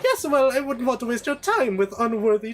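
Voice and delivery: high, fussy deferential servant; Jarvis. Acted. Servant screening challengers for his queen, fussily deferential. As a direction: start high and fuss over your queen.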